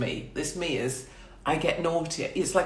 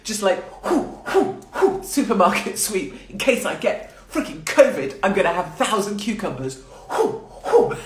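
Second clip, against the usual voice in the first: high, loud whooping panic-buyer; hammed-up supermarket sweep frenzy; mock frantic shopper; 6 semitones higher, 4 dB louder than usual. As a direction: rush through the aisles whooping. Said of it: "do a piss take of that".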